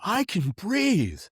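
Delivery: tearful relief. Retold or imitated. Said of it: "tears coming down my face".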